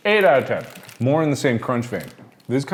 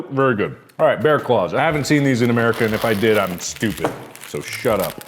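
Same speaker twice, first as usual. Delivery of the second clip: normal talking voice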